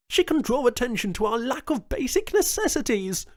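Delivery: high, loud, urgent plea for aid; Jones's high pleading voice. A narrator's reading. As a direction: push the point hard. Jones pleads, then Martinez echoes.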